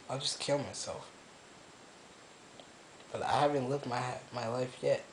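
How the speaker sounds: hushed and hopeless, barely above a murmur; quiet, broken confession; quiet despair. Real, not acted. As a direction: speak low like you have given up. Quiet despairing confession alone in room.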